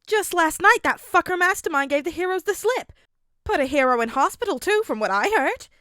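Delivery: high, loud, brash mother ranting over dinner. A narrator's reading. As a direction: spit it out like a foul-mouthed mum.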